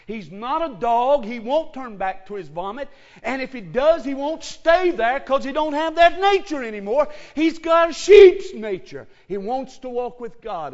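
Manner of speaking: fiery preaching, building to a shout. Real, not acted. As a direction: thunder it from the pulpit. Preaching at full force.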